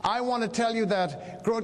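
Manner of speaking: ordinary delivery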